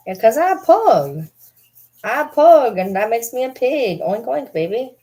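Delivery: high, loud talking-pug voice; playful pug voice. Pretend. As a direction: answer as a cheeky pug. Voicing the pug's answer.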